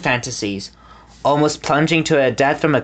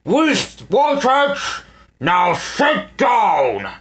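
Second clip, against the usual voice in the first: commanding villain; 7 semitones higher, much slower than usual. A narrator's reading. Snatcher ordering.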